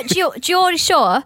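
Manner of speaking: put-on slow, careful Geordie accent on "Geordie Shore"; Geordie accent try. Host trying out a Geordie voice: slow Geordie accent. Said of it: "let me talk like the Geordie Shore".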